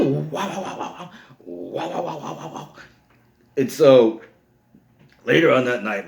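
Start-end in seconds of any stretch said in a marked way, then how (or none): 0.1-2.7 high, rapid wow-wow babble; imitated wow-wow racket. Storyteller mimics the slapping fracas, high babbled noise. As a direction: mimic a commotion in pitched-up noises.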